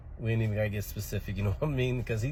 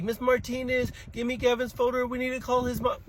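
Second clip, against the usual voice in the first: put-on high childish parody of a teacher; mock teacher; 12 semitones higher than usual. Child mimicking the teacher asking for a folder.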